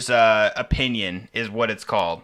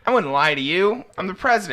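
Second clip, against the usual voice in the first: Biden impression; 7 semitones higher than usual. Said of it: "Was that Biden?".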